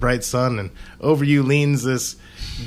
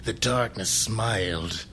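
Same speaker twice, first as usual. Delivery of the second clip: noir detective; 2 semitones lower, 5 dB quieter than usual. Pretend. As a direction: brood it like a hard-boiled detective. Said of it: "narrated film noir style".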